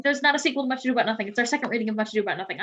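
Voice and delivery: rushed, rapid-fire correction; talking fast. Real, not acted. Host hurriedly correcting the title of the reading, talking fast. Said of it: "I'm talking real fast".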